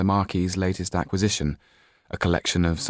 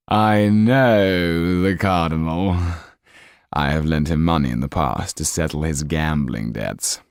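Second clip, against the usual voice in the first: sly Count; 4 dB louder than usual. Acted. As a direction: savour each word, amused. Count boasts to Marquis.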